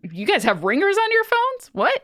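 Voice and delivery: incredulous, pitch leaping very high; high-pitched disbelieving question; can't believe what you just learned; real astonishment. Real, not acted. Learns teens keep phone ringers on.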